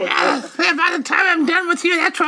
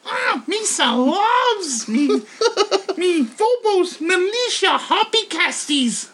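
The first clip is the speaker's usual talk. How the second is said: slow, goofy alien voice; Jar Jar Binks; slower than usual. Pretend. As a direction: do a silly Gungan. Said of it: "Jar Jar Binks doing it".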